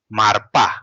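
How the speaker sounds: marpa said with a clear r before pa; the r is sounded in marpa. Shown as a demonstration. Teacher shows how the two syllables join. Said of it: "we produce ra, marpa".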